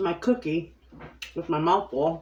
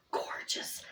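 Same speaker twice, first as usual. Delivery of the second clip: whispering; 12 dB quieter than usual. Real, not acted. Admiring cookies, unvoiced.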